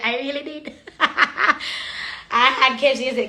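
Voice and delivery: giggling through the confession; laughing. Real, not acted. Laughing as she admits to viewers she ate KFC.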